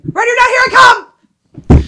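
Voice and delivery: very high, loud little-voice holler; tiny child voice. Retold or imitated. Host imitating the voice outside the window, tiny shriek. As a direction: imitate a small kid yelling at full pitch. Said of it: "literally, tiny little voice going".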